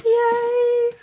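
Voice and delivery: high, loud squeal of delight; excited cheer. Real, not acted. More confetti falling out.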